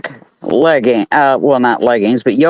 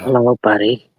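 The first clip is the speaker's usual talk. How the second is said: sexy voice; 5 semitones lower than usual. Pretend. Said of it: "tell us what you had on in your sexiest voice".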